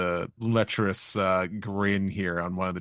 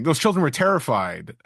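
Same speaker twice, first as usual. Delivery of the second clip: mock scolding, pitched up and louder; 7 semitones higher, 6 dB louder than usual. Nami chewing out the kids, mock scolding. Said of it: "she's chewing them out".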